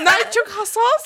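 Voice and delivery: high, slow laughing mock; laughing mock word. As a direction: giggle through the made-up word. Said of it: "det er ikke tjokkhassas".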